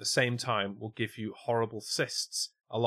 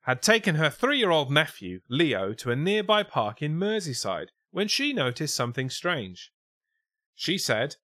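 lifted, projected newsreader delivery; news story read; 5 semitones higher, 5 dB louder than usual. Acted. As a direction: read it out like a silly news report. Reads the news story aloud.

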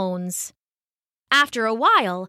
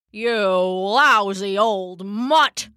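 slow, mock-angry old man's yell; narrated old man's mild angry shout; Papa shouting; much slower than usual. A narrator's reading. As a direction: scold the dog as grumpy Papa. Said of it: "Papa shouted".

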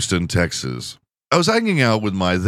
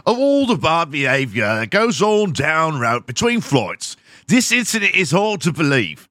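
mock British newsreader, a touch higher; British accent; 7 semitones higher than usual. Comedian reads the airline source in a British accent. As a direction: put on a posh British accent for the quote. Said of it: "I need to do a British accent".

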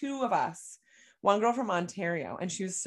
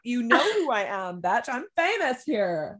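loud, higher-pitched playful outrage; mock indignant retort; 3 semitones higher, 6 dB louder than usual. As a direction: act out a cocky, indignant comeback. Retold indignant retort to locals.

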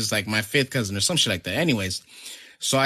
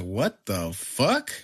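very slow, drawn-out disbelief; retold disbelief; 4 dB quieter, much slower than usual. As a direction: stretch each word in stunned mock disbelief.

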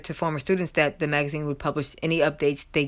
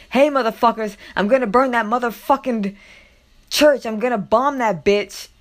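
mock furious rant, higher and louder; acted angry threat; 6 semitones higher, 6 dB louder than usual. Podcaster quoting a bomb threat to a school, angry imitation. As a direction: imitate an irate caller, loud and raised. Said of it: "This is what she said".

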